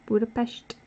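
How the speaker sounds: "Budapest" as BU-da-pesht, with SH at the end; correct pronunciation. Shown as a demonstration. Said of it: "pronounce it correctly".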